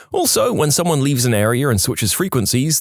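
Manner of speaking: normal talking voice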